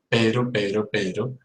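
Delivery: Pedro with no puff of air; soft Spanish P. Shown as a demonstration. Teacher drilling the Spanish P. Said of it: "In Spanish".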